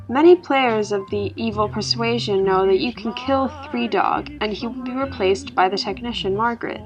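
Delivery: plain reading voice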